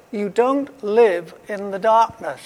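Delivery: ordinary talk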